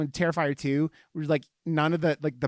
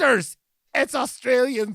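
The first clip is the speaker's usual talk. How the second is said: bad Australian accent; 7 semitones higher, 4 dB louder than usual. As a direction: parody the beer ad in a badly faked Australian accent. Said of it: "I sound like a terrible Scottish".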